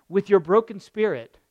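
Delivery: usual speaking voice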